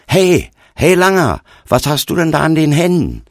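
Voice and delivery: loud, high-pitched call; challenging shout. A narrator's reading. Stranger pointing up at the tall boy, shouting a challenge. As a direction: shout up at someone sharply.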